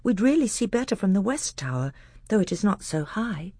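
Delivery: lightly voiced eager boy; a hint of a keen young prince.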